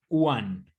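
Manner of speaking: One said as wan; One like U A N, no G at the end; English one. Shown as a demonstration. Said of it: "Es como U A N". (One como "uan", sin G)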